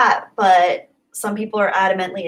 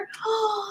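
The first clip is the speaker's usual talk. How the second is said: delighted gasp; 13 semitones higher, 9 dB quieter than usual. Real, not acted. Touched by super chat.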